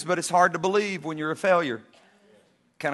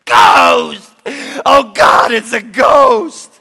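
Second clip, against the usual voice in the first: loud, high screaming panic, slowing at the end; terrified scream; 3 semitones higher, 10 dB louder, much slower than usual. Acted. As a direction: yell it like you've seen a ghost. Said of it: "One of them screams".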